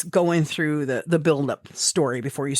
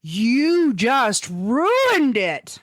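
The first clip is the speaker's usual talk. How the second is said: slow, drawn-out high whine; mock mad; 7 semitones higher, much slower than usual. Wife to husband who interrupted, slow drawn-out whine. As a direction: stretch it out in mock outrage. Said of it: "I was mad".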